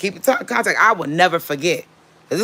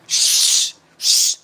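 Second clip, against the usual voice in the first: insistent drawn-out shushing; imitating a husband shushing; whispering. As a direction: hush her urgently. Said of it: "is you whispering".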